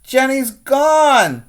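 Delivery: high, loud, slow alarmed shout; Emily shouts. Acted. Girl in class shouting that her snake escaped, alarmed. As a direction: cry out in a panic. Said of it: "Emily shouts".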